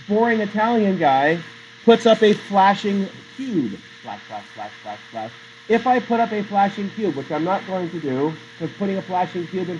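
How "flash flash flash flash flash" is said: much softer, lower, ticking out each "flash" evenly; soft rhythmic flashes. Retold or imitated. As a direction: mimic a light blinking on and off, quietly. Imitating a flashing cube.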